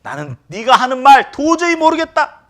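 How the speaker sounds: high, loud flat denial; Peter's denial. Retold or imitated. Peter denying knowing Jesus, high loud. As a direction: blurt the denial loudly.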